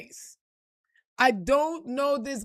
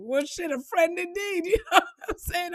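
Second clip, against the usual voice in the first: laughing; 7 semitones higher than usual. Real, not acted. Laughing at her luck.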